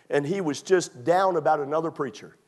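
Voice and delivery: ordinary talk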